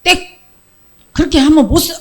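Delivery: loud, high-pitched scolding outburst; angry rebuke. Acted. Peter protesting to Jesus about the cross, angry loud rebuke. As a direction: burst out in an indignant reprimand. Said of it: "너무 화가 나갖고".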